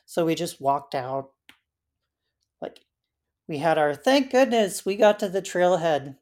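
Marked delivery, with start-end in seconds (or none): 4.0-6.2 high, loud burst of relief; relieved exclamation. Retold or imitated. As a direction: exclaim it with relief, louder and higher. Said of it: "like, feeling".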